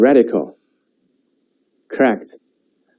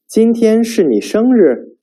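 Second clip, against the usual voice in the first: 今天是你生日 asked, much higher, rising on 生日; rising question tune; 10 semitones higher than usual. Shown as a demonstration. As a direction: raise 生日 up like a surprised question. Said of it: "pay attention to the intonations".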